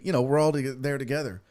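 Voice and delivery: usual speaking voice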